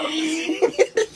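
high, wheezing laugh; hysterical laughter. Real, not acted. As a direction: crack up helplessly. Hysterical laughing after nose drink.

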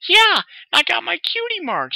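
very high, loud and proud; excited filly. Acted. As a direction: show it off, bursting. Filly shows off cutie mark.

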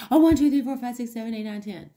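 hurried, careless counting, too fast; rushed counting. Shown as a demonstration. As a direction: rattle off the numbers in a rush. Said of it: "our brains get ahead of, like, our fingers and we count too many".

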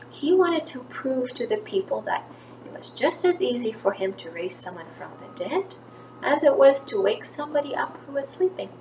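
normal talking voice

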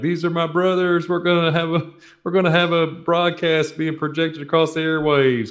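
high, showy announcer voice; mock grand announcement. Introducing his co-hosts to gas station customers, high mock announcer. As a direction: ham up presenting his brothers. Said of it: "Be like".

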